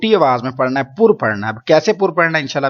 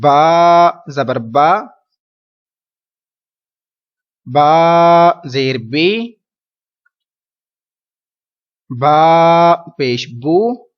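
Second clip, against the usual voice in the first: با، بی، بو spelled gently and very slowly, no jolt; soft slow spelling; much slower than usual. Shown as a demonstration. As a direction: read it softly, the right way. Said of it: "بلکہ نرمی سے پڑھنا ہے". (با، بی، بو نرمی سے آہستہ پڑھنا)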